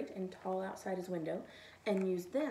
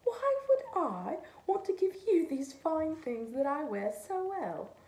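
loud, higher mocking snowman; scornful snowman; 5 semitones higher, 5 dB louder than usual. A narrator's reading. Snowman mocks the cat's request, loud and scornful. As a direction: sneer at the stray. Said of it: "The snowman laughed at the cat".

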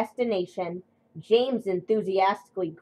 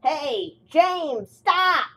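shouted, rising much higher; calling out; 8 semitones higher, 5 dB louder, much slower than usual. Acted. An engine yells after James as he leaves, shouting. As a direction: yell after him to stop.

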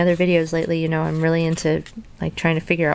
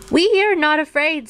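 high-pitched storybook cry of panic; mock scream; 9 semitones higher, 3 dB louder than usual. A narrator's reading. Frog and Toad together after the hawk, mock scream. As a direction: scream it in play, high but contained. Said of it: "screamed Frog and Toad".

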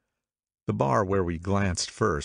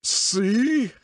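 much higher, louder, triumphant; triumphant cry; 5 dB louder than usual. A narrator's reading. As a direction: crow it like a winner. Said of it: "he cried triumphantly".

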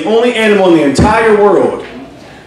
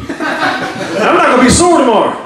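ordinary delivery

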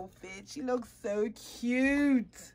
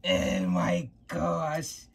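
slow, low, awed oh my gosh; breathless wonder at the doll; awed gasp; 8 semitones lower, 4 dB louder, much slower than usual. Real, not acted. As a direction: let the awe out slowly.

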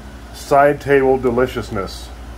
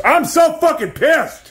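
much higher, slow, drawn-out cry of frustration; angry outburst; slower than usual. Real, not acted. Venting to the camera about the wrong dryer cord, real frustrated outburst. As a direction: stretch it out, fed up.

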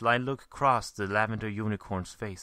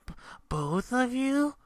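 much higher, squeaky, stammering; 4 dB louder, slower than usual. Acted. Spike talking in his sleep, voice going squeaky. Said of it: "his voice going kind of squeaky".

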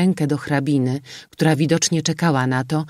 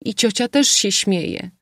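z rozpaczą; 6 semitones higher than usual. A narrator's reading. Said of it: "rzekła z rozpaczą".

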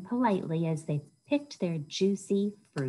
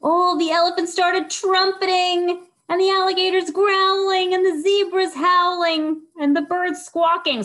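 much higher and louder, excited; 13 semitones higher, 8 dB louder than usual. A narrator's reading. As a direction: call it out big and wild. Jungle erupts in noise.

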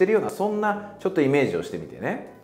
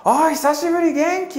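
much higher and louder, a happy call across the room; calling back; 6 dB louder than usual. Pretend. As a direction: call back, thrilled to see them. Friend calls back across room. (入口の友人が呼び返す高く大きな声)

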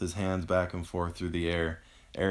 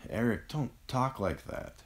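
higher-pitched, tearful protest; understated sob in a raised pitch; sobbing; 6 semitones higher than usual. A narrator's reading. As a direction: beg him through tears. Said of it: "Avery sobbed".

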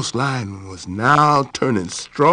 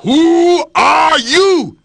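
slow, loud lion's roar down a well; growling shout; 12 semitones higher, 5 dB louder, much slower than usual. Acted. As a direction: bellow it into the deep. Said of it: "he growled down into the deep well".

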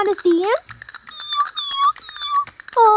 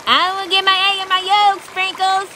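usual speaking voice

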